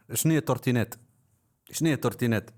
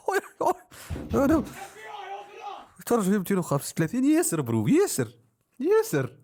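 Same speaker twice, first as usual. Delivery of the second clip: giddy excitement; 12 semitones higher than usual. Real, not acted. Streamer thrilled at two hundred viewers, squealing high. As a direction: squeal it like you can't believe the numbers.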